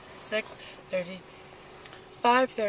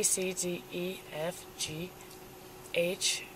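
ordinary talk

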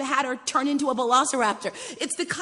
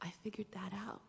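whispered quote; 4 semitones lower, 20 dB quieter than usual. Retold or imitated. Daughter's quiet admission, retold.

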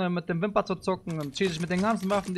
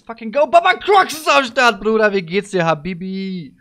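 hyped shout; 4 semitones higher, 10 dB louder than usual. Real, not acted. Hyped start of the round.

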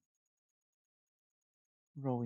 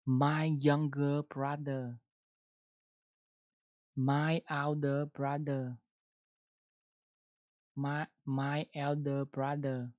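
slow model reading; 4 dB louder, slower than usual. Shown as a demonstration. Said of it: "các bạn đọc".

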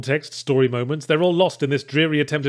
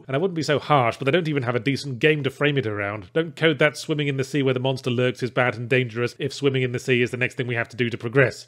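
ordinary talk

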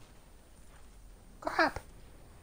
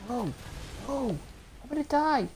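high, loud panicked cry; panicked yell; 8 semitones higher, 5 dB louder than usual. Real, not acted. Gamer yelling at swarming enemies in panic. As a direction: yell it as death closes in.

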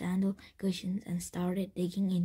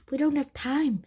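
loud, high, urgent protest; panicked urgency; 6 semitones higher, 6 dB louder than usual. A narrator's reading. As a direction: cut them off in alarm. Panicked refusal as rider nears.

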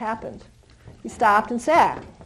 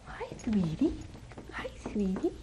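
much softer hi sweetie; soft greeting; 2 semitones lower, 14 dB quieter than usual. Real, not acted. Trainer greeting the little puppy: soft gentle coo.

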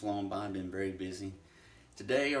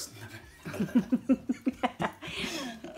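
high laughing fit; 5 dB louder than usual. Real, not acted. Breaks up during retake.

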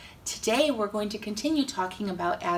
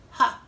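sly laugh; 7 dB louder than usual. Acted. Sneaky serpent's mocking laugh.

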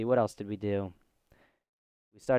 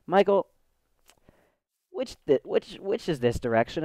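high, rising mock-mom question; imitating mom; 5 semitones higher than usual. Son imitates his mom asking which way, high mock voice. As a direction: voice your confused mom asking directions. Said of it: "my mom's just like".

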